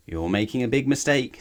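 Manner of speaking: lightly voiced worm. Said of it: "said a worm".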